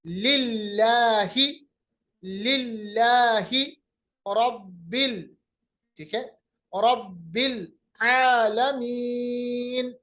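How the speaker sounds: slow recitation with long held vowels; tajweed recitation. Shown as a demonstration. Teacher reciting for students to repeat, slow tajweed. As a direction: recite phrase by phrase, drawing out vowels.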